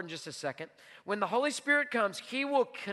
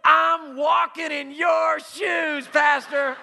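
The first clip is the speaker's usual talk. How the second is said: high, loud, slowly stretched-out cry; retold jubilant shout; 7 semitones higher, 11 dB louder, much slower than usual. Homeless man to pastor in donated shoes, retold jubilant shout. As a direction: proclaim it at full volume, word by word.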